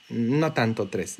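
ordinary talk, nothing marked

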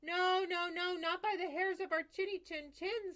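very high, loud pig squeal. Acted. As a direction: squeal it out like frightened piglets. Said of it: "squealed the pigs inside".

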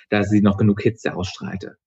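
plain reading voice

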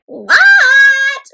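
high, long yelled "what"; startled reply. A narrator's reading. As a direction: shout back when caught daydreaming. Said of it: "said Froggy".

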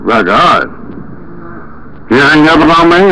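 usual speaking voice

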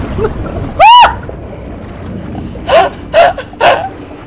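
high airy laugh after a scream; nervous squealing laugh; breathless scared laugh. Real, not acted. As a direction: laugh out the fright.

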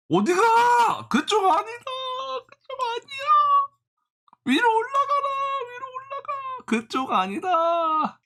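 very high, whiny falsetto begging; pleading high voice. Pretend. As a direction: plead with the chart like a wailing child. Begging falling stock price.

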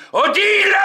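high, loud calling out; yelling for Odile; shout. Acted. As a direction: call her name across the store. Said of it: "Je crie".

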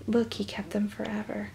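hushed awe; whisper. Real, not acted. Whispered aside to viewers.

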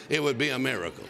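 ordinary delivery

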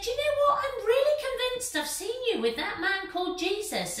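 put-on high, insistent girl's voice. Servant girl accusing Peter, in a high insistent voice. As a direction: press him like a sure young girl. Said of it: "And then she said".

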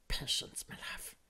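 very soft, breath-only whisper; whispering creature. Acted. Soothing his other self, hushed creature whisper. Smeagol talking to himself.

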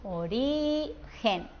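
"Origen" very slow, RI held long, then a soft GEN; stress on middle syllable. Shown as a demonstration. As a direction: stretch the RI of "Origen" to show the stress. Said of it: "en la del medio". ("Origen" lento, alargando la sílaba RI)

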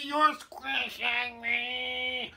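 high, very slow squashed wail; drawn-out high protest; yelled. A narrator's reading. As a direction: drag the words out as if being squashed. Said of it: "yelled Haley".

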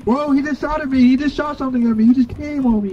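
high, loud alarmed yelps; freaking out as it attacks; startled panic. Real, not acted. Creature shoots at him.

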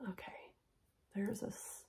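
unvoiced whisper while fiddling with the necklace. Real, not acted. Vlogger adjusting a necklace on camera, whispering. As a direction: barely breathe it out. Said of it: "I don't know why I'm whispering".

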